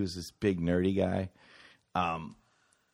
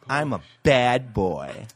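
mock bad boy; 4 dB louder, slower than usual. Put-on voice.

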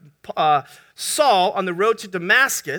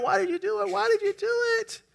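high, strained dying-frog plea; acted drowning frog, pitch climbing on the repeat; gasping frog; 9 semitones higher, 4 dB quieter, faster than usual. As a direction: plead high as if running out of air. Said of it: "The frog's gasping for air".

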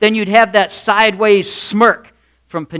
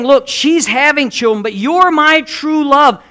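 raised pitch, slowing into an emphatic declaration; husband reassuring; 6 semitones higher than usual. Acted. Husband comparing his two wives, high emphatic reassurance. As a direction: plead it high, drawing out the love. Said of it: "And he's saying".

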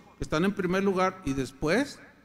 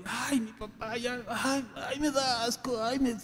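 put-on whiny disgusted child; 4 semitones higher, 7 dB quieter than usual. Grown child disgusted by parent.

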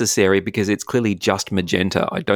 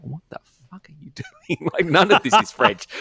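high, hushed, laughing disbelief; appalled wife; 9 semitones higher than usual. Retold or imitated. Wife reacting to his French recital, mock appalled. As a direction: imitate a baffled wife through giggles. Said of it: "and she's like".

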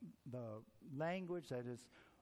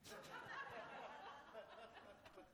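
soft high laugh at his own joke; 5 semitones higher, 12 dB quieter than usual. Real, not acted. Preacher laughs at his own joke. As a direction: laugh warmly at the punchline. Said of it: "I like that one".

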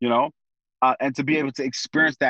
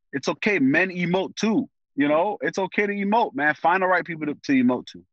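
ordinary talk

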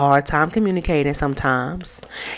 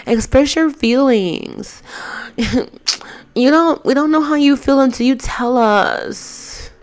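high, drawn-out whiny plea; mock pleading woman; 9 semitones higher than usual. Shown as a demonstration. Vlogger mimicking a woman pleading with her man. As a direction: ham up a girlfriend begging him to open up. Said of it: "I said it just like that".

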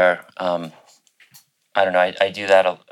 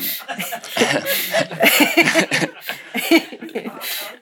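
breathy unvoiced laugh. Real, not acted. Laughing at rejection story.

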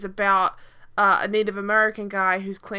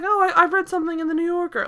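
higher and quick, a hammed-up wannabe aristocratic accent; mock posh accent; 8 semitones higher, 3 dB louder, faster than usual. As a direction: parody a pretentious TV actor's fake-British accent, pitched up. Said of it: "Yeah, like, just like".

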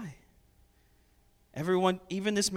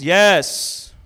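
loud, drawn-out sarcastic yes; 12 dB louder than usual. Shown as a demonstration. Micaiah answering the king, loud sarcastic yes. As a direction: drip sarcasm on the single word. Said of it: "This is how I do sarcasm".

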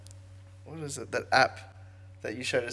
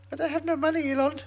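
mock Amber Heard; 12 semitones higher than usual. Voicing Amber pleading with Elon.

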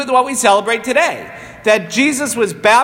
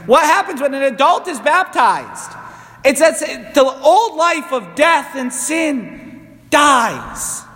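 raised-pitch sermon delivery, slowing to the end; emphatic preaching; 5 semitones higher, slower than usual. Acted. Priest proclaims the old life's death, emphatic preaching. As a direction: proclaim it, landing hard on the final word.

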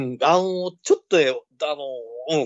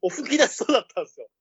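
trying not to laugh; 8 semitones higher than usual. Real, not acted. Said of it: "もう吹き出しそうだった".

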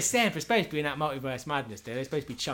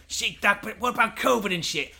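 higher, louder patient; mock patient; 8 semitones higher, 5 dB louder than usual. A patient questioning Doc Smith, higher and louder. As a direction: challenge the doctor.

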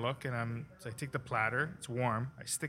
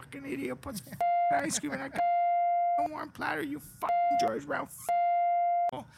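furious Italian chef; 4 dB louder than usual. Retold or imitated. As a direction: rant like an enraged chef. Chef Franco ranting.

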